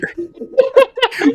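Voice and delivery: very high shrieking giggle; squealing laugh. Real, not acted. Reader squeals with laughter at the absurd line.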